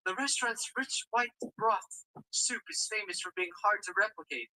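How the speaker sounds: ordinary talk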